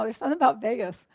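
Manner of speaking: normal talking voice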